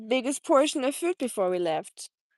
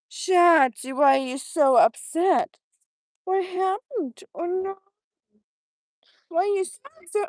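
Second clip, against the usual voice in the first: high, cooing pet voice for an upset dog; 8 semitones higher, 5 dB louder than usual. Real, not acted. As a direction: coo at him in a high voice to calm him.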